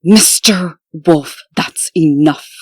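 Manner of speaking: loud, slow, firm "that's enough"; stern rebuke. Acted. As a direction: shut him down with authority. Partner stops the detective.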